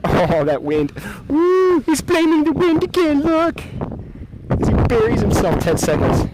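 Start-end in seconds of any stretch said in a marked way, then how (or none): 1.3-1.8 excited high-pitched whoop. Real, not acted. Cheering at gust of wind. 1.8-5.6 high, loud mock commentator; sports commentator voice. Mock commentary about himself.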